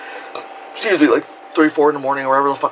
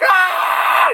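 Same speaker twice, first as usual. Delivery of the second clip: screaming. Retold or imitated. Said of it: "a lot of screaming".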